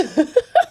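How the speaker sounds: sudden high giggle; short high laugh; burst of laughter. Real, not acted. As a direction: laugh at yourself out loud. Laughs at being close to camera.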